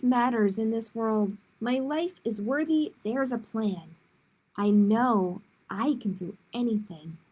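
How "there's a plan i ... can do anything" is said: usual speaking voice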